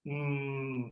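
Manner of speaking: ordinary delivery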